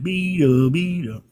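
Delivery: high-pitched singsong siren imitation; Minions siren. Host imitates the sound behind an emote, high cartoon siren. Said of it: "the minions behind it".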